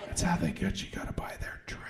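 hushed conspiracy whisper; paranoid whisper. Acted. Confiding to the audience about the tray scam, whispering.

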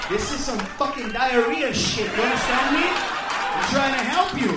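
warning his friend about the hot sauce; insistent shout. Retold or imitated. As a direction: yell it to save him. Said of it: "I'm like".

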